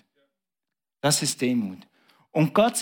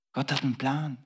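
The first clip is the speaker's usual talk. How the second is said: softer, intimate; hushed; 6 dB quieter than usual. Acted. Repeated hushed line.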